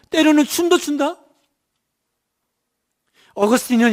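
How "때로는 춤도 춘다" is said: rising higher, bright with delight; a lightly voiced joyful God; joyful divine voice. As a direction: sing out God's fond joy. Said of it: "하나님 말씀하시는 거예요".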